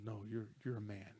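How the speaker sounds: soft, low-key mentor's assurance; gentle imitation of a mentor; retold reassurance. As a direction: quietly affirm him, as retold. Retelling mentor reassuring player.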